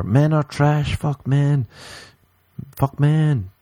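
high mocking chant of angry posts; mocking women online. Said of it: "they'll be posting all over everywhere".

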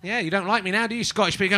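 ordinary delivery

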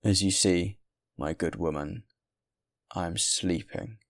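harsh and angry. A narrator's reading. Weary traveler answers the good woman, harshly and angrily. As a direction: answer curtly angry, slowing on the last words. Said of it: "He answered harshly and angrily".

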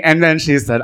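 normal talking voice

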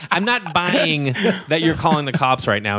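normal talking voice

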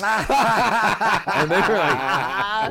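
words squeezed out through real laughter, slowed; laughing. Real, not acted. Telling the band's reply, laughing hard.

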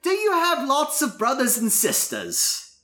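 put-on high-pitched British-accented Skylar; Minnie Driver accent. Skylar quizzing Will on his family, in a British accent. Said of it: "do it in the Minnie Driver accent".

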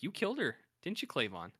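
high-pitched mock interrogation; playacted cop accusing a suspect; mock accusation. Retold police accusing a suspect.

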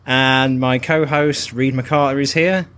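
put-on slow, drawn-out American accent; host the show in a put-on American drawl; hammed-up American host, breaking into a laugh; mock American accent. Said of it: "introduce the show in an American accent".

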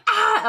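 high sudden yelp of alarm; mock startled yell. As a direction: blurt it out like a false alarm in the car. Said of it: "the other day I was like".

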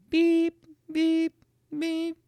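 very high, even electronic beeps; microwave beep. Retold or imitated. Husband imitating the microwave alarm, high beeping. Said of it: "the microwave alarm go off".

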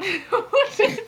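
high, real laugh; burst of laughter. Real, not acted. Friend recalls a favourite line, high laughter. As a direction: crack up, pitch leaping high.

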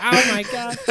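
high, laughing mock exclamation; laughing oh my God. Retelling the boat name, laughing high. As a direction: gasp it out mid-laugh in a high voice. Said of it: "It's just like".

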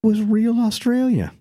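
a high, drawn-out Aussie twang, teasing; Australian accent. Pretend. Teasing friend about the fake Aussie, Australian accent.

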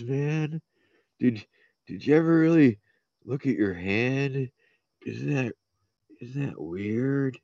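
spaced-out stoner voice, laughing; stoned stoner. Pretend. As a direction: play a dazed stoner on a head rush. Stoned guest acts high.

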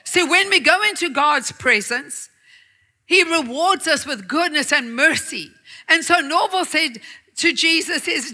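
usual speaking voice

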